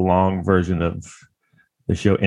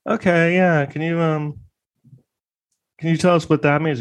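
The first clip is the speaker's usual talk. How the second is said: higher, knowing lawyer question; much higher mock prosecutor; 9 semitones higher than usual. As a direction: play the lawyer springing the trap. Lawyer voiced in retelling.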